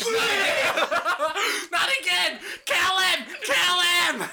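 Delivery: high furious yelling; angry mob shout. Acted. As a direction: scream like a mob.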